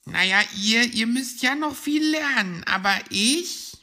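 high, loud little hedgehog; self-important high squeak; boastful youngster. Acted. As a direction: brag in a high child-like voice. Borstel boasts to the others.

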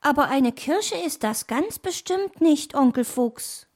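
put-on high young-animal voice; young fox. Nephew contradicts his fox uncle, high young voice.

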